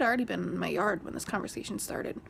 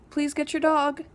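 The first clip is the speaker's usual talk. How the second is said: retold plea; 6 semitones higher, 8 dB louder than usual. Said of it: "And I'm like".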